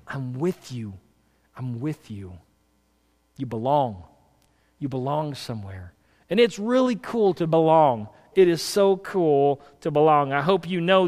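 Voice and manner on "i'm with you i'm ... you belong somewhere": much softer and lower, almost breathed; tender, hushed. Acted. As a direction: speak it like a loving promise in the ear. Tender repeat of God's promise.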